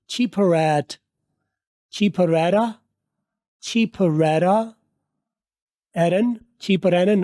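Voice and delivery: "चीपरैरा" with the r of cheaper linked onto the next vowel. Shown as a demonstration. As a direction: join the r of "चीपरैरा" into the next word. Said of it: "वापस reverse करनी है रे में". ("चीपरैरा" में आर को अगले vowel से जोड़ो)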